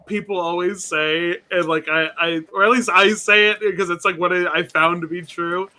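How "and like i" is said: ordinary talk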